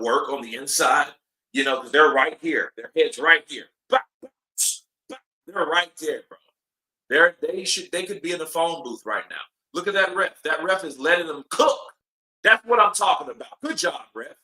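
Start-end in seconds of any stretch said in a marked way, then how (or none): none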